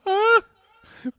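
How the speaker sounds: very high, loud yelp; very high, loud mock squeal; shrieking cry. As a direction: imitate his shocked squeal. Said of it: "Hace así un ruido".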